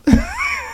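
very high shrieking cackle; creepy shriek laugh. Retold or imitated. Larys squealing with delight at the piggy in a shriek.

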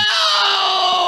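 long, high-pitched held yell; drawn-out theatrical wail; mock Vader scream. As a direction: scream it out in hammed-up agony. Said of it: "better than Vader when Padme died".

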